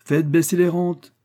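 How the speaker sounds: loud, high exclamation; crying out. A narrator's reading. Banker shouting his wish among the guests, crying out. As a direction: call out the demand forcefully. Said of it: "s'écria le banquier".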